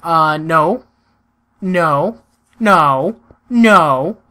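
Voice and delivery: escalating loud refusals; loud protest. Real, not acted. Friend breaking the game.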